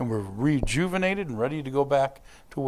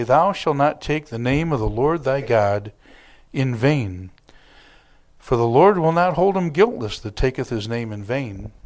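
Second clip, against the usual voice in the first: solemn, louder King James recitation; scripture reading; 6 dB louder than usual. Acted. Pastor reading the commandment to the congregation, solemn. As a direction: proclaim the commandment like a pulpit reading.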